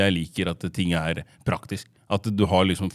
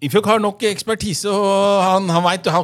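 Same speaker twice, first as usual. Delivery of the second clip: teasing voice; 8 semitones higher than usual. Pretend. Teasing praise of friend.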